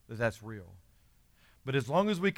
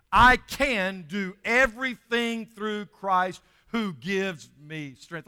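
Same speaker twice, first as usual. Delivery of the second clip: loud, slow, higher group reading; leading verse aloud; 7 semitones higher, 11 dB louder, much slower than usual. Real, not acted. Preacher leading the congregation in a verse, loud and slow. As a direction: lead the church through the verse, drawn out. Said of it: "everybody read this verse with me".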